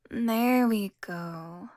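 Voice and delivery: very drawn-out, savoured words; slow, satisfied purr. Acted. Vampire satisfied as prey complies.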